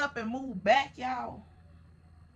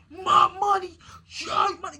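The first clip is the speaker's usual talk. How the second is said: towering rant; 5 semitones higher than usual. Retold or imitated. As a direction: bark it down at her from above. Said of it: "he towering over me like".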